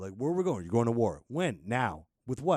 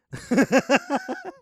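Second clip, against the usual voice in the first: very high, loud cackle; real high-pitched laughing fit; shrieking laugh; 11 dB louder than usual. Real, not acted. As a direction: crack up hard and high. Laughing at own story.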